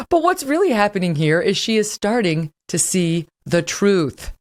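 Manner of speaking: ordinary delivery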